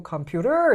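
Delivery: 컴퓨터 with the last syllable 터 strong; stress on 터. Shown as a demonstration. Teacher lists possible stress places, stress on 터. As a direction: hold and push the final 터. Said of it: "세 군데가 있다는 거예요".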